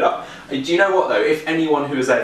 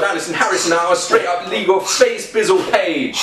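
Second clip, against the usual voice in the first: higher, hammed-up gangster reading; reading a baking headline as a gangster; mock gangster; 6 semitones higher than usual. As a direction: put on the street swagger. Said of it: "in gangster".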